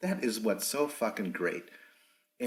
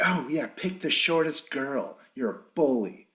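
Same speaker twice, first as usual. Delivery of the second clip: higher-pitched mock indignation; imitating pissed-off; 6 semitones higher, 4 dB louder than usual. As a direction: retell it as an annoyed, sarcastic complaint. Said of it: "Natalie gets really pissed".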